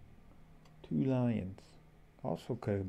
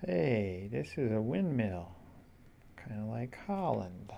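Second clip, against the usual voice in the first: usual speaking voice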